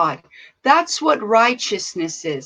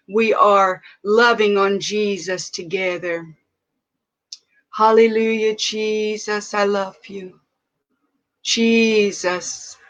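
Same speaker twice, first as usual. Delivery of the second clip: prayer; 3 semitones lower than usual. Real, not acted. Pastor praying to God at livestream start, hushed and tender. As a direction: pray tenderly, as if alone with God.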